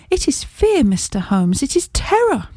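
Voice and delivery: high, trembling-edged cry of fear on fear and terror; frightened confession. Acted. Frightened lady confesses her terror to Holmes, voice rising high. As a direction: let the terror break through on the key words.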